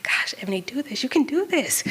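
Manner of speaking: hushed self-encouragement, almost no voice; whispered pep talk. Retold or imitated. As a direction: psych yourself up under your breath. Pep talk to herself underwater.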